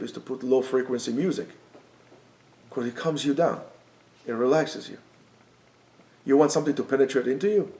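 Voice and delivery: ordinary talk, nothing marked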